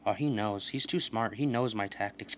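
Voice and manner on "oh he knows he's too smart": ordinary talk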